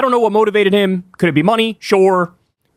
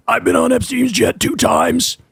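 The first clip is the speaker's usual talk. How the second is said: higher-pitched mocking imitation; mock RFK; 6 semitones higher than usual. Imitating RFK answering a Fox host, mocking and high. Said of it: "he was like".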